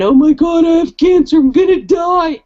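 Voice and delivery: high, loud mock wail; imitated moping panic. Patient imitating friend who moped about cancer, wailing. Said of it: "she did mope around".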